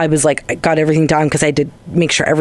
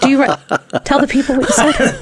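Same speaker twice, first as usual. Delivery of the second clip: giggling through the question, higher; pressing her while laughing; laughing tease; 9 semitones higher, faster than usual. Real, not acted. Teasing partner to confess, laughing.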